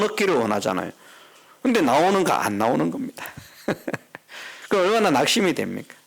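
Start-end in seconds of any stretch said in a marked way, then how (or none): 3.7-4.6 short, soft chuckle; soft, voiceless laugh; rueful chuckle. Real, not acted. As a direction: laugh quietly at the letdown. Laughs wryly at people not coming.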